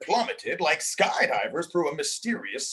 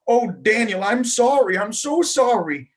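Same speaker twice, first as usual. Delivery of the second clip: cried apology; 7 semitones higher, 7 dB louder than usual. A narrator's reading. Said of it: "she cried".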